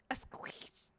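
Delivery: whispered tries at a squish; real whispered rehearsal; whispered practice. Real, not acted. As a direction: whisper it to yourself. Whispers to test pronunciation.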